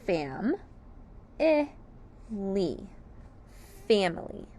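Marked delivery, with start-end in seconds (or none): 0.0-2.9 "fam, I, li" with a pause after each syllable. Shown as a demonstration. Teacher gives the syllables of family, broken apart. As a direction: split "fam, I, li" into three separate beats. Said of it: "The syllables of family are".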